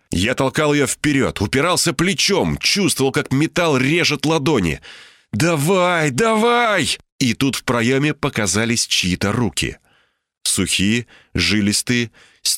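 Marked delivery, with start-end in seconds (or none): 5.3-7.0 high effortful urging, rising in pitch; straining urging. A narrator's reading. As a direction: urge harder on the repeat. Straining to push Inga out. (натужное «давай», всё выше)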